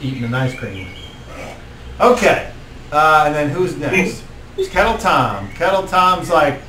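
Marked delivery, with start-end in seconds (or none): none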